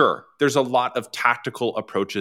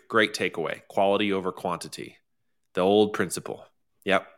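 usual speaking voice

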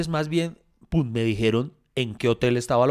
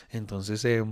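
normal talking voice

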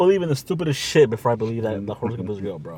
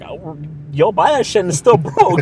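mock hype; 5 semitones higher, 7 dB louder than usual. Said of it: "I was like".

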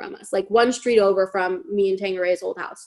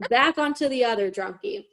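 normal talking voice

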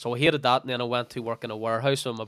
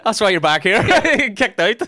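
laughing; 9 semitones higher, 12 dB louder than usual. Real, not acted. Teasing friend, both laughing.

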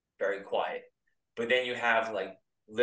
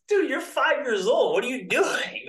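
much higher, louder, stunned; can't believe a kid said that; incredulous disbelief; 14 semitones higher, 5 dB louder than usual. Retold or imitated. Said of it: "you're just like".

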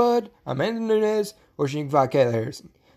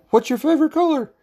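lightly mocked high, louder question; mocking her question; 10 semitones higher, 4 dB louder than usual. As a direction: parody her nosy social media ask. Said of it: "she'll ask you, like".

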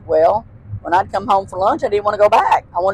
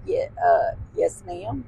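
small, quiet yes ma'am; timid quiet reply; 2 semitones higher, 9 dB quieter, slower than usual. Retold or imitated. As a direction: answer shyly, barely audible. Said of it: "I said".

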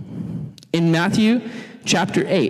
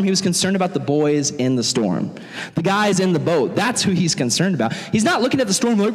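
ordinary talk, nothing marked

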